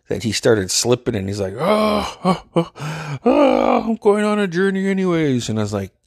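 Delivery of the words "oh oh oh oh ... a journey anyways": mock dramatic wail. Slipping friend insists he's going on a journey, mock wailing. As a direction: imitate a melodramatic friend wailing in a high voice.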